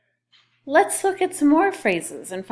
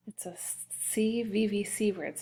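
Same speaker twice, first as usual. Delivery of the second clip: usual speaking voice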